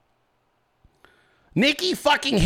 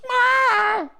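parrot squawk. Pretend. Bird squawk mockery.

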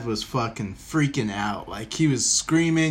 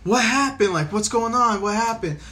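higher-pitched, urgent questioning; mock-panicked mom voice; alarmed mom; 7 semitones higher than usual. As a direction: imitate a worried mother demanding answers. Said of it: "And she's like".

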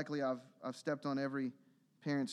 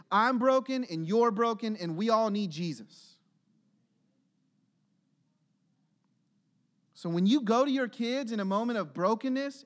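rising, louder preacher's exhortation; fervent preaching; 5 semitones higher, 6 dB louder than usual. Real, not acted. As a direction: press the congregation hard, building. Preaching at full force.

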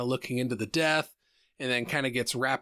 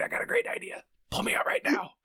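whispered, scheming tempter; conspiratorial whisper; 9 semitones higher than usual. Acted. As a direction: whisper it like a secret bad idea. Voicing the body part as tempter.

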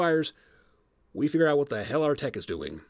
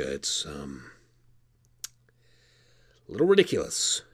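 ordinary talk

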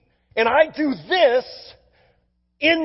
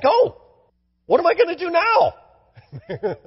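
a high, laughing mock gasp of surprise; 8 semitones higher, slower than usual. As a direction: play it as a bewildered little joke, slowing down at the end.

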